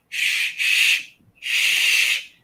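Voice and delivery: urgent whispered shh; villagers shushing. Acted. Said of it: "they start trying to shush you".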